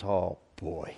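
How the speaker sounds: the sinking realization, under the breath; dismayed hush. Acted. Saul realizing he was wrong.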